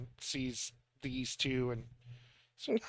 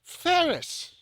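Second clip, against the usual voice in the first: much higher and louder, calling out in delight; overjoyed cry of a name; 5 dB louder than usual. Acted. Mother spots her long-lost son and cries his name, much higher and louder. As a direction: greet a long-lost child with a joyful shout. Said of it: "and just goes".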